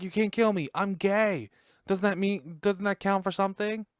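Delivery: high, loud mock pleading; Robbie. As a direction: protest for your life, hammed-up. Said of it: "He's like".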